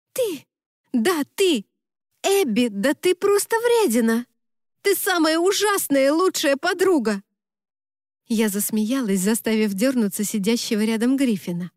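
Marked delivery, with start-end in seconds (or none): 0.1-7.2 high, exasperated outburst; indignant scolding. A narrator's reading. Best friend berating the bride, indignant scolding. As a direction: pile on accusations in a raised pitch.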